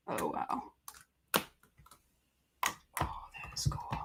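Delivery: whispered wonder; soft whisper of delight; awed whisper. Real, not acted. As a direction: breathe it in quiet amazement. Marveling at the result.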